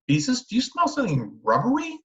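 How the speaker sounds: a high, anxious question from mother; worried mother sniffing the air. A narrator's reading. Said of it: "she asked anxiously".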